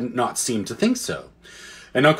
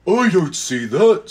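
bear voice; 4 semitones higher, slower than usual. A narrator's reading. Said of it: "said one of the bears".

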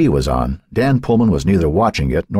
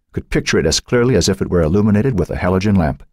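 plain reading voice

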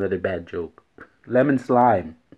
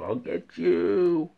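slow, higher monster voice on I'll get you; creepy monster threat; 9 semitones higher, much slower than usual. Pretend. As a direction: menace them like a ghoul. Spooky threat, second take.

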